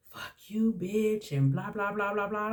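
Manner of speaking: heated insult trailing into mocking chatter; retold screaming. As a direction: imitate an angry tirade without shouting. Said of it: "she was just screaming and yelling".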